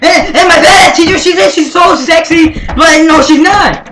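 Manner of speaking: ordinary talk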